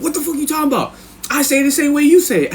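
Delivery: high-pitched indignant imitation; confused cellmate. Cellmate insisting he says it alike, confused high voice. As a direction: protest high and baffled. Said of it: "he'd be all confused".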